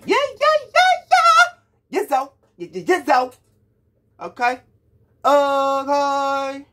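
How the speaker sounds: high, loud excited yeahs; hyped yeahs. Real, not acted. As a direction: cheer along to the track. Hyped singing along yeahs.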